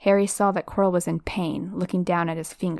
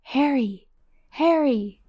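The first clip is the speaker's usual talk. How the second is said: distant calling; 6 semitones higher than usual. Acted. Voices calling Harry.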